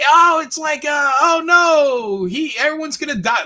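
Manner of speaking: high, loud mock panic shouted out; over-the-top shrieking mock doom. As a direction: ham up a frantic newscaster's alarm. Mocking hyped-up news reaction.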